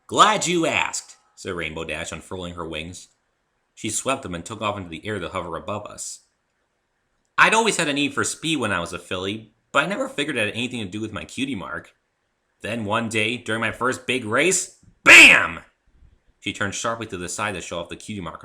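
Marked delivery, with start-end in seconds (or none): 0.1-1.2 high, loud and slow, full of pride; bragging pony voice. Acted. Rainbow Dash showing off to the new guy, high and slow. 7.4-14.8 higher, louder tomboy pony voice building to a shout; boastful pegasus. Acted. Rainbow Dash bragging to the new guy, building to a shout. 15.0-15.6 sudden loud high shout; shouted bam. Acted. Rainbow Dash hitting the climax of her story with a shout.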